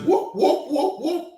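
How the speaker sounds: high, whooping police siren; quick high siren whoops; imitated police siren. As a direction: whoop like a squad car pulling up behind. Imitates police car behind them.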